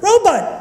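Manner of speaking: robot pitched very high, ending "but"; high-pitched robot with a short "but". Shown as a demonstration. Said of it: "just say robot".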